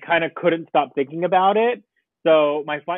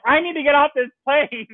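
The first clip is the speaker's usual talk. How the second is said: panicked plea; 10 semitones higher, 3 dB louder than usual. Retold or imitated. Panicking passenger begging attendant.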